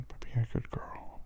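soft praising whisper. Acted. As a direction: hush the praise. Praising in a hush.

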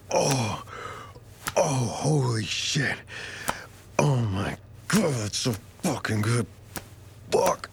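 high cry of pleasure. Acted. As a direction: let the pleasure catch in the throat. Lover overwhelmed with pleasure.

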